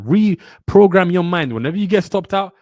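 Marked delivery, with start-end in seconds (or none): 0.0-1.5 loud, slow, every word pressed down; forceful slow mantra; emphatic command. Real, not acted. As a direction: drill it into them like an order. Hammering home a mantra.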